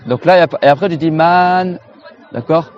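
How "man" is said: Man with a long drawn-out A; prolonged A. Shown as a demonstration. Teacher showing students Man with a prolonged A. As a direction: stretch the A of Man. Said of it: "Tu dois prolonger le A".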